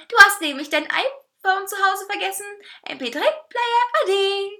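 high, loud, taunting cake voice; gloating talking cake. A narrator's reading. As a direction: gloat loudly, stretching the last words out. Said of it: "Er lachte".

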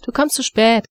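calling out. Acted. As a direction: shout it from the next room. Mother calling son out of bed.

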